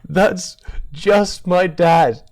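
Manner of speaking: said slowly through laughter; high and loud through the laugh; giggling it out. Real, not acted. Laughing at dad.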